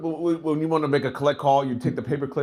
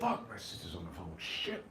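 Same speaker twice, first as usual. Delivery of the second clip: softer, lower muttered swearing; mock annoyed grumble kept down low; hushed curse; 9 semitones lower, 16 dB quieter than usual. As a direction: curse under your breath so she won't hear. Caught by sister on the line.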